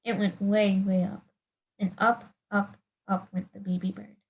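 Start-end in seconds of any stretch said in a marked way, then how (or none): none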